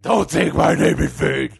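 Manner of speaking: rough growled warning; Batman-like God. Acted. God warns the man praying, Batman-like growl. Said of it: "I still sound like Batman".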